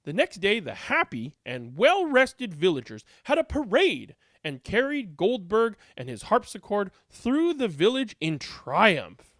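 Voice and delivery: usual speaking voice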